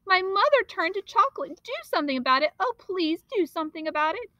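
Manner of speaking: high, urgent pleading; boy pleading for his mother; frantic pleading. Acted. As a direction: beg him desperately.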